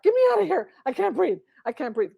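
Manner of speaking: high, loud panicked plea; mock panic. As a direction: voice the panic of someone who can't breathe. Panicked client voiced in therapy talk.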